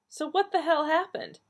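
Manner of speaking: high-pitched, exasperated question; exasperated demand. A narrator's reading. Donna pressing her family for answers, high exasperated demand.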